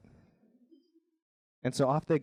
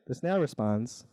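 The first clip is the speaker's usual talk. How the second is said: plain reading voice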